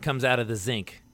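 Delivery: zinc said with a z for sink; Baltimore pronunciation. Retold or imitated. Telling co-host where water comes from, Baltimore zinc. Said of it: "That's more of a Baltimore thing".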